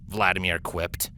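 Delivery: plain reading voice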